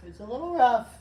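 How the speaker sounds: hoarse voice with a crack. Real, not acted. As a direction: let the voice crack, raspy. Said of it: "Got a little crack right there".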